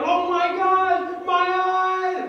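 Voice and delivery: high, loud, drawn-out wail; mock screaming. Students react to naked witches on screen, mock screaming. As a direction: cry out in hammed-up horror. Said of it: "She starts scre-, like, we're like".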